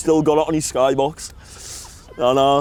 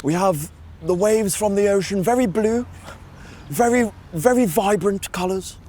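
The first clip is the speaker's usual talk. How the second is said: higher, slow hushed nature narrator; David Attenborough; 5 semitones higher, slower than usual. Retold or imitated. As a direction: narrate the wilderness like a nature documentary. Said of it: "me David Attenborough one's the best".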